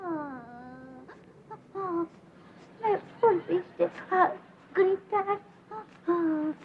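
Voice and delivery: put-on slow, sobbing childish complaint; hammed-up crying reproach; tearful whimper. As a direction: whimper it between drawn-out sobs. Crying after being yelled at.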